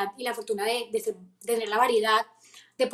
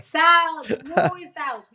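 insistent correction; 5 semitones higher, 6 dB louder, much slower than usual. Retold or imitated. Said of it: "y yo".

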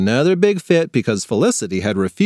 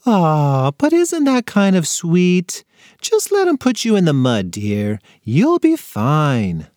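cooing mom; 5 semitones higher, slower than usual. A narrator's reading.

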